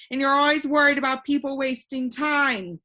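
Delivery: put-on a cheerful know-it-all boy floating in the air; voiced boy Alec. Alec to Talk.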